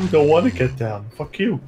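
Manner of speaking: high-pitched sulky talking dog; pet dog voice. Acted. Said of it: "the voice is always like".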